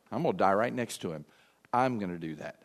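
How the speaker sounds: low, soft and resolved; a quiet, steady vow to die beside him. Retold or imitated. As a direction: say it low, like a firm promise. Thomas vowing to die too.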